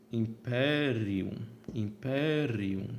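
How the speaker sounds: "Imperium" slowly, PE drawn out and stressed; tônica na antepenúltima. Shown as a demonstration. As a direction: stretch the stressed syllable each time: imPEErium. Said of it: "a antepenúltima é que é a tônica". ("Imperium" devagar, alongando o PE)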